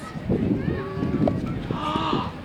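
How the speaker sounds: soft surprised gasp. Acted.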